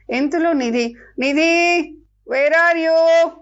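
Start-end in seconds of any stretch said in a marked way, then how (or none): 1.2-3.3 loud, high call across the garden. A narrator's reading. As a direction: grandpa shouting for the girl. Grandpa calling from the house.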